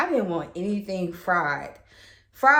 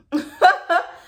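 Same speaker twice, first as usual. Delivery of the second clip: high laugh; 11 semitones higher, 7 dB louder than usual. Real, not acted. Despairing over positive tests.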